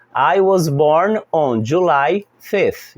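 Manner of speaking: "I was born on July fifth" said very slowly, each word separate; slow model sentence. Shown as a demonstration. Teacher giving the birthday example, very slow and clear. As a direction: spell it out slowly for learners. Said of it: "Por exemplo".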